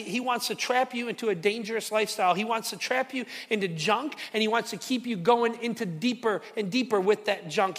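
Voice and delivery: ordinary talk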